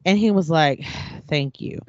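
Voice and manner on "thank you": soft, deflated thank you on a sigh; exhaled thank you. Retold or imitated. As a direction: breathe it out, worn down and resigned. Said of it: "it was like this exhale and thank you".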